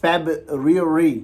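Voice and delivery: February as feb-ree-oo-ree, very slow; drawn-out February in pieces, feb-ree-oo-ree; spelled-out pronunciation. Shown as a demonstration. Teacher giving an example word, February slowly as feb-ree-oo-ree. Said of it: "जिस तरह".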